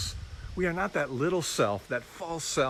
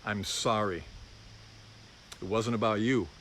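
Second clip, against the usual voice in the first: tender apology; 5 semitones lower, 5 dB quieter than usual. Real, not acted. Father gently apologizing to his son Lucas, soft and sincere. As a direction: sincerely ask your son's forgiveness.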